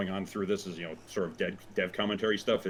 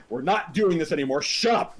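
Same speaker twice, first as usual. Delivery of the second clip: exasperated shout; 6 semitones higher, 6 dB louder than usual. Retold or imitated. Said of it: "the self-aware character would have seen the pattern".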